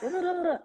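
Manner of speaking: high, rippling tongue-flutter noise; busy British-tongue babble. Shown as a demonstration. Actress mocking how Brits overdo articulation, busy babbling noise. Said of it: "Whereas Brits are like".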